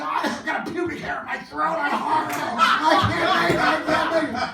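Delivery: high, hammed-up tearful whine; mock wailing excuse. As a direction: wail it like you're falling apart. Wailing excuse while acting out crying.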